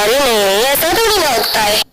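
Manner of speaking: loud, embarrassed no, brushing off the tease; flustered loud denial. Acted. Friend teases Wangji about love, he denies it loudly and flustered. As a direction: blurt it out loud, flustered and caught.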